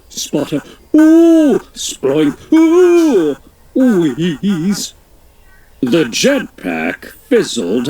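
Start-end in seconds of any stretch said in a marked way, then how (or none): 0.9-3.4 loud, high wailing ooh; cartoon ooh of alarm; high ooh cries. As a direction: yelp as the engine fails. Jetpack failing in story.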